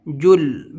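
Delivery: جل with the ل stopped dead, no echo; جل بغیر قلقلہ کے; stopped sound. Shown as a demonstration. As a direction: stop the ل of جل right at its place. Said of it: "بالکل آواز رک جائے وہیں پہ".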